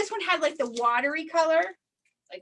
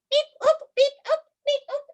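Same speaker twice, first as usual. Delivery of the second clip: high, loud robotic beeps; alien beeps; 11 semitones higher, 5 dB louder than usual. Acted. Librarian beeping as the alien for the kids. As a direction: beep like a little alien.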